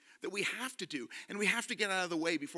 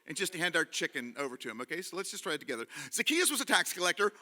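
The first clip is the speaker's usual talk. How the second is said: ordinary delivery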